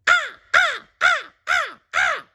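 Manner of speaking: very high harsh caws; loud high crow cries; crow cawing. A narrator's reading. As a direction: caw like a big crow. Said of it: "cawing loudly".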